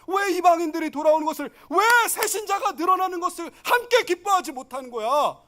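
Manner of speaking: rising to a high loud rebuke; building rebuke; rebuking Jesus. Retold or imitated. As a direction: confront the Pharisees, building. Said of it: "큰아들이 누구라고요".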